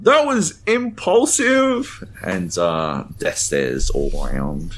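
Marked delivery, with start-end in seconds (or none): 0.0-2.0 high, slow, drawn-out yell. Retold or imitated. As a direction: shout it out, stretched and shrill. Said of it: "and I yell out".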